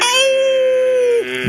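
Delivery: sudden high yelp of pain; pony stung on the nose, a high yelp. Acted. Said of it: "Mavis yelped".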